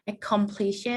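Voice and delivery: usual speaking voice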